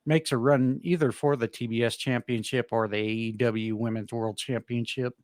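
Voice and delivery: ordinary talk, nothing marked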